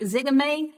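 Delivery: "Zigame?" said ZIH-guh-may with a questioning rise; checking the name, "Zigame?" as zih-guh-MAY in a southern way; southern pronunciation. Shown as a demonstration. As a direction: ask "Zigame?" drawn out, short i, rising at the end. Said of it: "That's my southern way of saying it".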